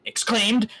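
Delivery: Exclaimed! shouted high and loud; mocking exclaim. Shown as a demonstration. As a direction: bark the word Exclaimed! back in mock outrage.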